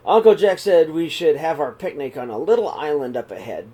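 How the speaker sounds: plain reading voice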